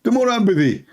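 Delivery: high mock voice of a dodging debater; mocking Zakir Naik. Mocking the debater who keeps dodging, high and dismissive.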